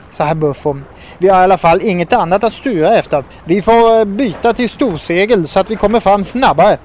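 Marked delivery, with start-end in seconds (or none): 1.2-6.8 lightly voiced toad, higher and louder; a hint of a bossy toad; urgent toad. A narrator's reading. Herr Buffo urging a bigger sail, lightly voiced urgency.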